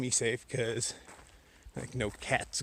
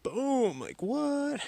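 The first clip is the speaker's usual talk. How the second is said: high, loud mock-amazed burst; big booming high exclamation; excited boom; 6 dB louder, much slower than usual. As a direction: whoop it up over the sudden blast. Shows headlamp at full blast.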